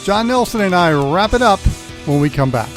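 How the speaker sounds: ordinary talk, nothing marked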